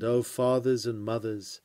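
ordinary talk, nothing marked

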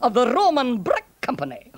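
deliberate Scots build-up to a joke; lilting Scottish burr with pauses; Scottish centurion, confiding. Acted. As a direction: deliver the gag as a droll Scots soldier.

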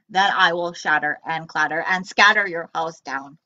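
low booming robot threat. Acted. Robot threatening Gork's house, low and loud. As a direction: rumble out the threat.

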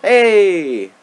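high, loud victory cheer; excited. Real, not acted. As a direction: shout out the win. Escaping the prison, cheering.

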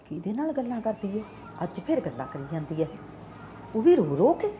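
low mumbled grumbling aside; suspicious muttering. Acted. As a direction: grumble it under your breath. Mother-in-law muttering aside. (ਸੱਸ ਦਾ ਮਨ ਵਿੱਚ ਬੁੜਬੁੜਾਉਣਾ)

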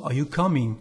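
ordinary talk

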